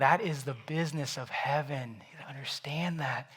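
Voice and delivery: breathy whisper, no voice at all; whispered father. Acted. Father whispering to his listeners about heaven's party. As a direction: hush it like a secret.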